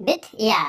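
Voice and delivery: বিথ ইয়া as "bid-ya", split in two; Sanskrit pronunciation. Shown as a demonstration. Teacher shows learners বিদ্যা, said in two parts as বিথ ইয়া. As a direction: sound a clear y in বিথ ইয়া, not double d. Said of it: "বিদ্যাটা উচ্চারণ কি হচ্ছে?".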